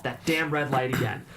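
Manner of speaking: ordinary delivery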